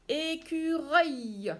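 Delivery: teacher announcing the last word; slow stretched word. Shown as a demonstration. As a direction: stretch écureuil so they hear the sound. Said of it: "Allez, allez".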